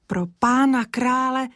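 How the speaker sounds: a touch of a startled cry; mock cry out. Týna cries out in fright on the path. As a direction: exclaim in sudden alarm. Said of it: "vykřikla Týna".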